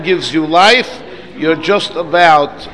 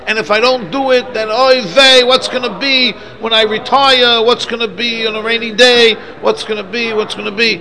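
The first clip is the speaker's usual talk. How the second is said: fretting worrier, pitched up; yiddish accent; 6 semitones higher, 3 dB louder than usual. Retold or imitated.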